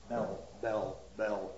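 low, soft, repeated "bell"; tolling bell. Shown as a demonstration. Lecturer revealing Shakespeare's pun, tolling bell. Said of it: "The ringing of a bell".

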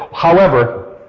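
usual speaking voice

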